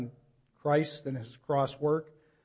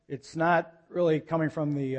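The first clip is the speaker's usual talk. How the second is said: ordinary talk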